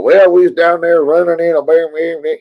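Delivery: exaggerated rural Southern caricature; mock hillbilly talk. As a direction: put on a thick NASCAR-country twang. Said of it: "don't talk all".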